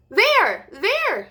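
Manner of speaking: high, loud pointing squeak; squeaky little-mouse excitement; excited squeak. Acted. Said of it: "he squeaked excitedly".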